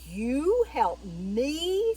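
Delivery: very slow, loud, swooping question; mocking big-lion astonishment; incredulous lion. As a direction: scoff in drawn-out disbelief. Lion scoffs at mouse's offer.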